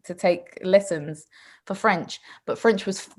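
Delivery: normal talking voice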